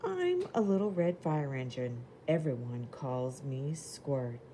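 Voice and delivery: lively picture-book reading voice; reading to children. Acted. Teacher reading a storybook to the class, lively. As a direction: read it bright and clear for little kids.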